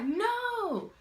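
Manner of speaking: high, loud, frantic whine; mock panicked pleading. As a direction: plead in a scared squeaky voice. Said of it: "I'm like".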